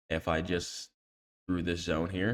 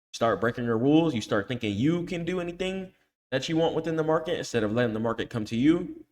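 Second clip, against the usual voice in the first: higher and louder, lecturing hard; heated scolding; 4 semitones higher, 5 dB louder than usual. Real, not acted. Coach scolds students who break their rules, heated scolding.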